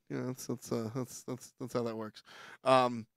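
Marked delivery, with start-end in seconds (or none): none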